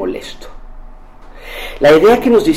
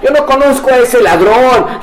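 negación; 8 semitones higher than usual. Acted. Pedro niega a Jesús.

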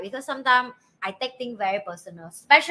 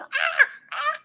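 short high-pitched mock squeals; imitated child's screaming. Mother imitating her daughter who continues screaming, mock squeals.

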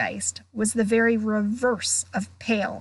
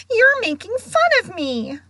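high, louder tearful whine; hurt accusation; 11 semitones higher, 5 dB louder than usual. Acted. Ghost accusing girl of mocking her, hurt. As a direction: accuse her, wounded and wailing.

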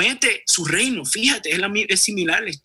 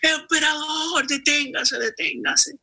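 very high, slow pleading shout; desperate shout; 11 semitones higher, slower than usual. Acted. Beggar boy shouting after the departing emperor, very high and desperate. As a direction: yell after the emperor begging him to stop. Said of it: "empieza a gritar".

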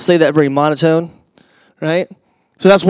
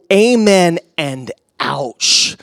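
slow emphatic; 4 semitones lower, 4 dB quieter, much slower than usual. Real, not acted. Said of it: "preacher's closing line".

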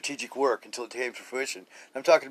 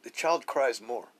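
usual speaking voice

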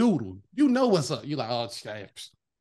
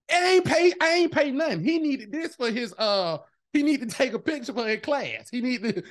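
retold crying friend; 6 semitones higher than usual. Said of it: "she cried".

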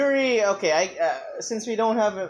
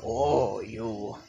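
a lightly lowered, slow creepy leer; ugly bastard voice; 6 semitones lower, 4 dB quieter, slower than usual. Acted. Friend requests an ugly bastard voice, lightly voiced slow creep. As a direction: ham up a sleazy anime villain, low and drawn out. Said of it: "do an ugly bastard voice".

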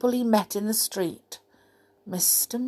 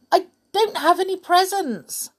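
high worried character voice; 11 semitones higher, 3 dB louder, faster than usual. A narrator's reading. Mister Happy protesting.

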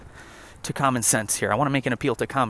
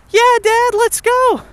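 put-on very high, louder little-kid voice; eager kid voice; 6 dB louder, much slower than usual. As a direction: squeal it like an excited child. Kids answer the dad.

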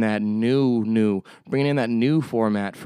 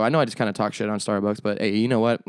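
normal talking voice

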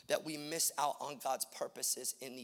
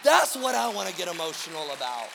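loud, driving sermon delivery with sustained, stretched stresses; impassioned preaching; 6 semitones higher, 11 dB louder than usual. Real, not acted. Preacher rallying the church to care about the right things, impassioned preaching. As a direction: preach it at full force, hammering each repeated phrase.

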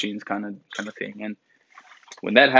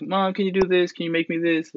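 put-on higher, pleading kid asking mom for favours; whiny kid; 7 semitones higher than usual. A mother imitating her grown kid begging, mock whine. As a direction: beg like a lazy child.

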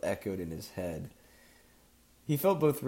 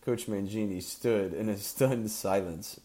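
usual speaking voice

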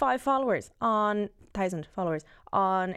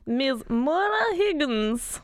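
slow, grand introduction, pitched up; mock announcer; 5 semitones higher, much slower than usual. Introducing the new villa arrival, mock announcer. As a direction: draw the name out like a contestant reveal.

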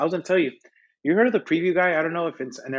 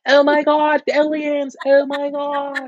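mock alarm; 13 semitones higher, 4 dB louder, slower than usual. Mock terror at the aliens.

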